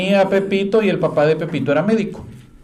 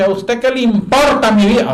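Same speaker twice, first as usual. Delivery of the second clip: angry shout; 7 semitones higher, 4 dB louder than usual. Retold or imitated. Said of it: "me grita la señora".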